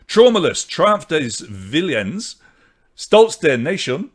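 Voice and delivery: hammed-up German accent, drawn out. Shown as a demonstration. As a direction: put on a slow, emphatic German accent. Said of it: "That's my German accent".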